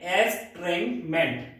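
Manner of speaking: slow, careful एस्ट्रेंजमेंट; careful pronunciation. Shown as a demonstration. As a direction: say एस्ट्रेंजमेंट slowly and clearly for learners. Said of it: "इसका जो synonym है".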